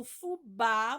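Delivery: fubá with a loud, drawn-out bá; stress on last syllable. Shown as a demonstration. Teacher helping pupils find the strong syllable, fubá stressed on bá. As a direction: punch and stretch the bá in fubá. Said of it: "você vai sublinhar o ba".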